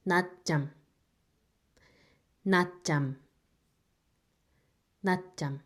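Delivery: teacher models "natjam" three times; Korean word natjam. Shown as a demonstration. Teacher sounding out "natjam" for students. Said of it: "these two syllable makes".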